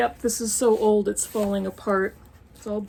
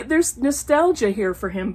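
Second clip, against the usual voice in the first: ordinary talk, nothing marked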